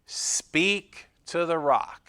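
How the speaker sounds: slow, each word spelled out; slow emphatic command. Shown as a demonstration. Pastor stressing God's instruction to Moses, slow and deliberate. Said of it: "God said what?".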